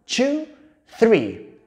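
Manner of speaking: very slow, drawn-out English words; slow, rhythmic picture-book chant; storytelling for toddlers. Shown as a demonstration. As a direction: count it out slowly for a tiny child. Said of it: "otra vez lo mismo".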